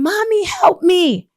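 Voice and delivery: slow high pleading; child's plea. Retold or imitated. Boy behind bars begging his mother, slow high plea. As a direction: stretch out a child's cry for help. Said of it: "the son starts saying".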